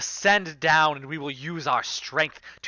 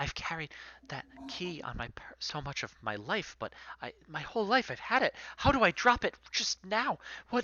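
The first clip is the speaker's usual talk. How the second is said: anxious whisper; 3 semitones higher, 7 dB quieter than usual. Acted. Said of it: "Mush is looking around saying".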